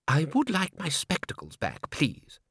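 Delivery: clipped, offended society lady; icy polite request; prim lady voice. Acted.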